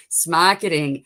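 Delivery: hammed-up Boston accent; playful attempt at a Boston accent; terrible Boston accent. Shown as a demonstration. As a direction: put on a bad Boston accent. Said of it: "do my terrible Boston accent".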